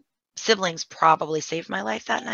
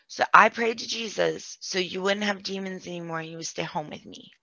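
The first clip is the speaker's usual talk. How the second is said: normal talking voice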